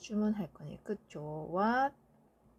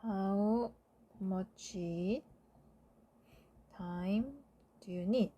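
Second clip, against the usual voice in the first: slow dictation; much slower than usual. Shown as a demonstration. Teacher dictating answer slowly.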